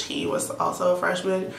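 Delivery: ordinary talk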